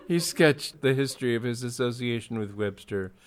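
ordinary delivery, nothing marked

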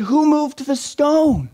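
high, slow amazed question; a slow, high cry of astonishment; astonished question. Acted. Mary finds stone moved.

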